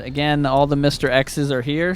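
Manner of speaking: ordinary talk, nothing marked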